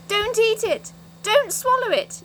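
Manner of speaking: high, loud alarmed yell; a frantic shout of warning; sudden yell. Acted. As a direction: yell it out to stop her eating. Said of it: "yelled Moonface suddenly".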